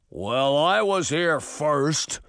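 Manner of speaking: slow, grumbling giant voice; complaining giant. Acted. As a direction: complain slowly like a big giant. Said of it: "a giant complained".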